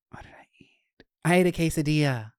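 unvoiced whisper to herself; whisper, thinking aloud. Real, not acted.

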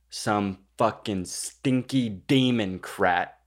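slow, drawn-out mock indictment; exaggeratedly deliberate, word by word; mock outrage. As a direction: spell out the accusation very slowly.

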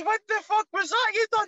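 squeaky, thrilled wee pal; excited teammate. Retold or imitated. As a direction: impersonate a buzzing teammate who can't believe it. Teammate raving about the goal.

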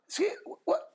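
high startled squeak of disbelief; shocked. Retold or imitated. Teacher shocked by cleaner's Hoovers, high squeak. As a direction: gasp it out in shock.

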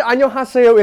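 put-on 안녕하세요 said high and loud in an Aussie accent; Australian accent. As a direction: mimic an Australian saying 안녕하세요. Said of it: "in an Australian accent".

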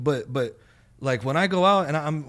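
ordinary talk

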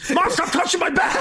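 very high panicked mock yelp; flipping out. Paranoid comic to his mother backstage, flipping out. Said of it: "I'm flipping out".

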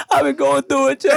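fake-cry for the camera; parodying a crying influencer post; mock crying for Instagram. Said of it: "let me get this filter right".

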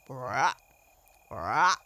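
low frog call, said loud; cheerful frog croak. A narrator's reading. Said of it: "his cheerful".

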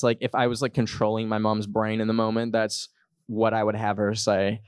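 usual speaking voice

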